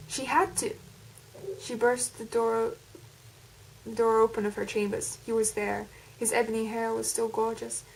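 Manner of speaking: English speaker attempting an American accent. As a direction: read the story in a put-on American accent. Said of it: "That's my attempt at an American accent".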